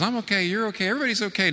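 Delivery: high, breezy mocking voice; mock narcissist. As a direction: parody a carefree smug tone, pitched well up.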